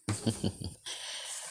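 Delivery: soft, drawn-out villain snicker; hushed, breath-only chuckle; evil chuckle. Acted. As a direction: chuckle quietly, toying with them. Villain toys with teachers.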